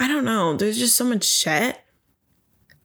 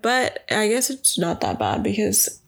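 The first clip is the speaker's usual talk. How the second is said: usual speaking voice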